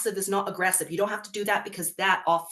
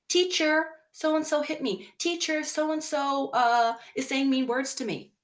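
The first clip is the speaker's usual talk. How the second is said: put-on higher, whiny kid telling on a classmate; tattling child; 7 semitones higher than usual. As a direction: plead to the teacher like a hurt second grader. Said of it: "they automatically go".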